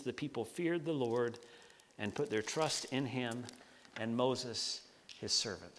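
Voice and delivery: measured, solemn Bible reading; scripture reading. Acted. Pastor reading Exodus aloud to the congregation, solemn.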